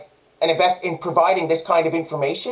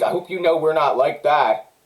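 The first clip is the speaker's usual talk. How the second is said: a lower, sneering echo of her reassurance; mocking repeat; 5 semitones lower than usual. Speaker mocks the woman's promise about the police, sneering. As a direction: mimic her line back with scorn. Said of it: "Listen to her".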